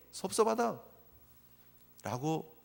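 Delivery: very slow, soft sigh of hurt; hurt father. Retold or imitated. Father telling his son he is hurt, slow and soft. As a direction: let the word sink slowly in disappointment. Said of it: "책망 들을 만한 모습이라는 거예요".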